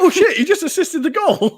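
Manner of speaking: high, laughing burst of disbelief; laughing excitement. Retold or imitated. Watching his friend assist on the news, laughing and excited. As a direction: squeal it out through a laugh.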